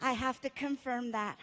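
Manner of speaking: lightly voiced soft-spoken nurse. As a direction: quietly insist as the nurse. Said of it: "I'm greeted by a nurse".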